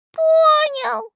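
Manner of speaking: very high, loud, drawn-out squeak; писк. A narrator's reading. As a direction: squeak it like a tiny creature. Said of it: "пропищал Листик-Ластик". (тоненько пропищать)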